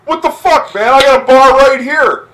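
high, loud mock outrage; indignant owner. Bar owner confronting him about the after party, outraged. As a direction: demand it like an offended bar owner. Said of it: "he's like".

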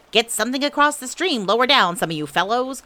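loud, high urgent order; calling out. A narrator's reading. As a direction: shout instructions to everyone ahead. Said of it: "called Rabbit".

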